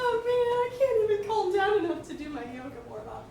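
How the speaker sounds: giggly. Acted. Laughing giddy in yoga zone.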